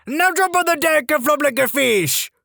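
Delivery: high, slow hammed-up drill sergeant. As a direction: bark the order in a silly voice. Sergeant shouting order.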